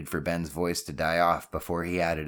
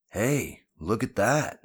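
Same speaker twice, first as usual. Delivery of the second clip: higher-pitched excited shout-out; excited call; 5 semitones higher, 4 dB louder, slower than usual. Acted. Drew spots something across the lot, calling out excitedly. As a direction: call your friends over, excited by a find. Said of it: "Drew called out excitedly".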